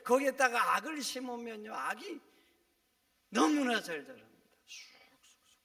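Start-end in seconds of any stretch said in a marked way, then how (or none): 4.7-5.6 쑥쑥쑥쑥 whispered high and soft. Acted. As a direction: breathe it like something sprouting. Whispered growth sound. (속삭이듯 쑥쑥쑥쑥)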